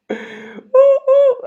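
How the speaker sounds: very high, slow, drawn-out dog howl; moaning dog impression. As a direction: whine like a sad moaning dog. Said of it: "this is my best impression of a dog".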